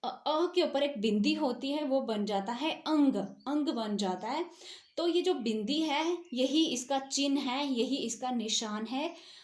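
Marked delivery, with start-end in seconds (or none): 1.8-4.5 अंग said with a nasal ng before g; nasal anusvara. Shown as a demonstration. Teacher demonstrating अंग to students, nasal ng clearly sounded. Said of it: "पहचान इसकी ये है".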